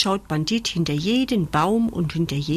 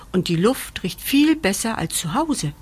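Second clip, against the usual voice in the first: ordinary delivery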